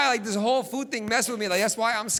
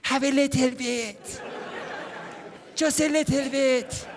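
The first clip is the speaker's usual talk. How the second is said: slow, sweet coaxing; coaxing mom; 3 semitones higher, slower than usual. Retold or imitated. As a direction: lure him in, slowly.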